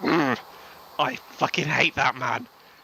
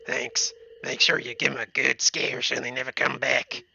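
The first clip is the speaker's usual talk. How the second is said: put-on old man character. Old man asking player to scare raccoons, put-on character voice. Reads game old man's dialogue.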